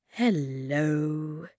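put-on drawn-out greeting, as to small children; slow, careful hello. A narrator's reading. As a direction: stretch the word out gently. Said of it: "he said very slowly".

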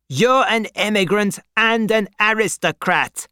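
high-pitched shout from the crowd; man shouting an accusation; accusing shout. A narrator's reading. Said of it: "shouted a man".

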